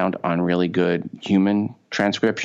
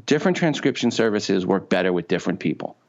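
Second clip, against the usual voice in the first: normal talking voice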